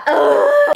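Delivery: loud, high-pitched groan of disgust; exasperated groan. Real, not acted. Host fed up with an overused joke, exasperated groan. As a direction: groan loudly in frustration.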